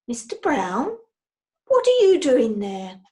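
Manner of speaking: puzzled little bear voice; surprised bear. A narrator's reading. Paddington finding Henry outside, startled.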